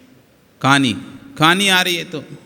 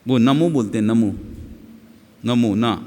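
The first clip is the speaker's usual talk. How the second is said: ordinary talk, nothing marked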